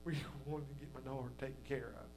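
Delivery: a hint of the anxious father, hushed; mock worried father. Father voiced in story.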